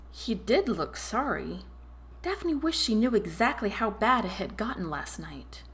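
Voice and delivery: ordinary delivery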